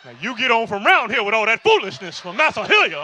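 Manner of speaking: loud, hammed-up slavish scolding; mock slavish outrage, loudly; slavish defender. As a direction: scold like an over-devoted old-time servant. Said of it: "Why are you talking like that?".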